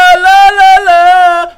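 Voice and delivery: shocked cry. Acted. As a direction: drag the cry out in disbelief. Shocked outburst at news.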